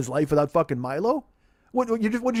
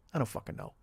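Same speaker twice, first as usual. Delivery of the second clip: under breath; 3 semitones lower, 12 dB quieter, faster than usual. Real, not acted. Admits ignorance under breath.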